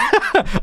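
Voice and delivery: quick high burst of laughter; a short squeaky laugh. Real, not acted. Recalling the groggy moment after surgery, high laugh.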